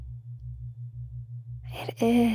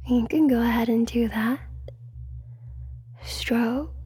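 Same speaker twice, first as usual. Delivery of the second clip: intimate ASMR whisper; breathy hypnotic whisper close to the mic. Acted.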